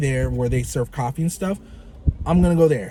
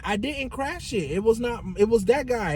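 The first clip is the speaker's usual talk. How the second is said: higher, softer pleading reply; mock defensive protest; 6 semitones higher, 5 dB quieter than usual. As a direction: protest innocence in a small raised voice. Acted defence in retold car quarrel.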